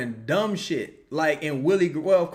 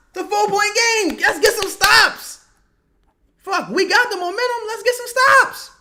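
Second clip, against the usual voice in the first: high, much louder pleading shout; frustrated yelling; 8 dB louder than usual. Real, not acted. Fan screaming at the team for stops, frantic and loud. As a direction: beg the team to defend at full voice.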